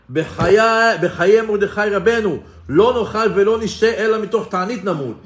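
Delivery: loud, high, declaimed vow; solemn oath. Retold or imitated. Children swearing on Mordechai's life to keep fasting, defiant oath. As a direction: swear it out loudly, as a retold oath. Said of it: "and they would swear".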